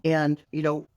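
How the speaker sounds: ordinary talk, nothing marked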